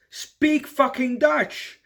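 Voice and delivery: high, loud, drawn-out outburst; exasperated shout. Acted. Tourist ranting at Amsterdam shop staff, exasperated shout. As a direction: yell each word slowly in sheer exasperation.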